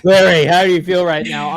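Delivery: loud, high mock press question; mock reporter question. A pretend reporter calls out to Larry at the presser, loud and high. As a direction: shout the question from the second row.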